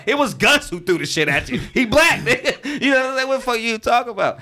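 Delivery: high, laughing comeback; laughing protest. Retold or imitated. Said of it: "I'm like".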